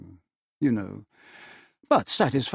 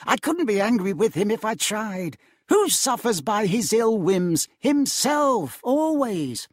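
higher, light amused voice; good-natured nephew; 9 semitones higher than usual. A narrator's reading. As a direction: forgive him with a smile.

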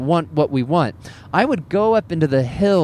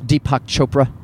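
usual speaking voice

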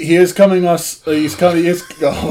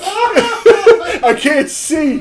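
high, slow words through laughter; crying with laughter; 8 semitones higher, much slower than usual. Real, not acted. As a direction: barely get it out, laughing till tears. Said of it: "blurred from crying".

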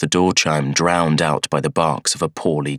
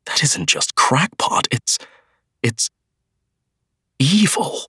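horrified; 8 semitones higher than usual. A narrator's reading. Horrified accusation.

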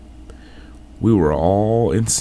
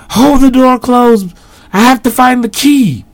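high strained yell; desperate shout; 9 dB louder than usual. A narrator's reading. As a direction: yell while holding the door. Desperate at the door.

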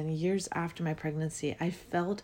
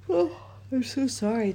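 yawning; 6 semitones higher, 3 dB louder than usual. Real, not acted. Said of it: "Maybe the yawning is my releasing".

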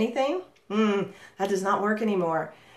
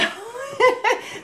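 high burst of laughter. Real, not acted. Laughs at her monotone demo.

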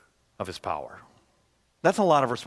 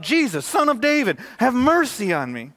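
raised pleading cry for mercy; 9 semitones higher, 5 dB louder than usual. Retold or imitated. Blind man crying out to Jesus, loud pleading. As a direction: call out to Jesus, high and loud. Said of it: "He cries out".

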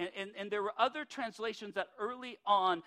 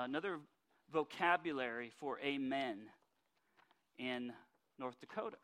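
ordinary delivery

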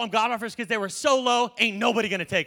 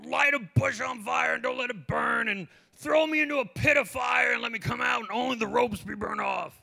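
demanding prayer; 3 semitones higher than usual. Retold or imitated. Believer demanding miracles from God, exaggerated pleading. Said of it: "And we're like".

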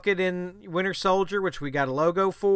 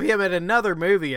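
ordinary talk

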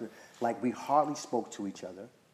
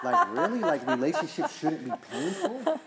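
high, louder, laughing through it; laughing disbelief; 6 semitones higher, 5 dB louder than usual. Retold or imitated. Telling the group his old self doubted love, laughing. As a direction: laugh at how absurd it sounded.

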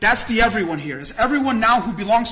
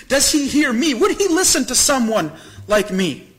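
higher and louder, building urgency; rising plea; 4 semitones higher, 3 dB louder than usual. Acted. Preacher to the congregation, pleading in a higher, louder voice. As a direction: press the question harder with each line.

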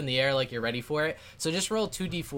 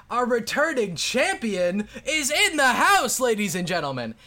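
booming high arena announcer; 9 semitones higher, 7 dB louder than usual. Acted. GM voicing the announcer to the arena crowd, booming. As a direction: hype up the crowd like a ringside MC. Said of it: "he's like".

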